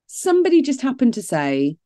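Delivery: ordinary talk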